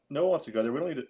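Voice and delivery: ordinary delivery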